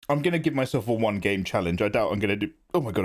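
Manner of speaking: ordinary talk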